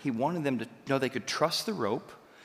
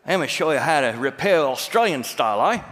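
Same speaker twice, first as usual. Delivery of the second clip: a light, louder mock Australian accent; lightly imitating an Australian instructor showing off; 8 dB louder than usual. Instructor shows students his trick, in mock Australian accent. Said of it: "suddenly he's talking Australian".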